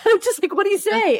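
high, laughing disbelief. Real, not acted. Mother laughing at how surreal it was, laughing disbelief.

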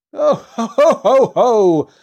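loud, high Santa ho-ho-ho; booming jolly storybook laughter; merry laugh. A narrator's reading. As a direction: laugh out merrily, big and high. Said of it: "he laughed merrily".

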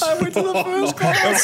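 high-pitched, laughing through the words; laughing protest. Real, not acted. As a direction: crack up while defending yourself. Laughing at co-host's tease.

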